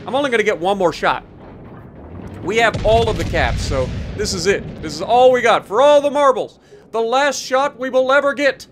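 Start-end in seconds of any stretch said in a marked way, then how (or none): 4.8-8.7 excited. Real, not acted. Final shot, hyped up.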